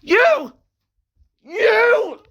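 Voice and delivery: high, loud crying accusation; crying in fear. Acted. N crying at the villain who killed Uzi. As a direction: sob out the word at the killer. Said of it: "crying in fear".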